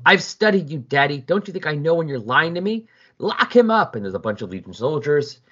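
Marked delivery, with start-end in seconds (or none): 0.0-4.0 a daughter's hammed-up tantrum, higher and louder at the end. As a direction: stamp it out like a spoiled child, ending on a high order. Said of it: "starts having a bit of a tantrum".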